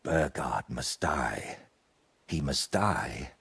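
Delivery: slow, low imitation of the voice in his head; mock whispering voice. Friend imitates the voice haunting him, slow and low. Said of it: "someone keeps whispering in my ear".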